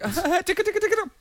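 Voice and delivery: put-on very high, loud, laughing baby-talk squeal; hammed-up squeaky tickle, giggling; silly tickling voice. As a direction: coo at the stingray like tickling a baby. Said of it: "And he's like".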